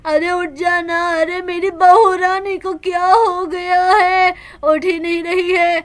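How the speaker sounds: keen over the daughter-in-law; wailing grandmother. Acted. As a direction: grandmother mourning her bahu. Grandmother laments her daughter-in-law.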